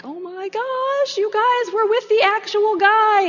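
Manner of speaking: high-pitched, thrilled squeal; excited disciples. Retold or imitated. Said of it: "getting excited".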